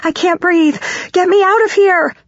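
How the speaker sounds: high, frantic plea for air; panicked scream. A narrator's reading. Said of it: "I scream in a panic".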